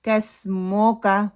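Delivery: کس مو کا with "mo" and a long final "kaa"; slow wrong "kas-mo-kaa"; majhool pesh. Shown as a demonstration. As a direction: mimic the slack o in مو. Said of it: "مو کو پھر اس کا مجہول کر دیتے ہیں".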